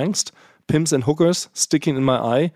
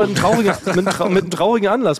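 stifled giggles; 6 semitones higher, 5 dB louder, faster than usual. Acted. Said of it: "Hört auf zu lachen".

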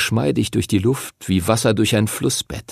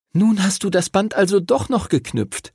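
put-on much higher girl's voice, pleased; Lucy, warm; 9 semitones higher than usual. A narrator's reading. Lucy hugs Henry, pleased the bond is made.

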